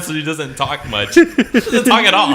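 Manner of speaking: high, slow words through real laughter; laughing while talking. Real, not acted. Co-host teasing about the one-year-old sister, cracking up. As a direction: crack up helplessly mid-sentence.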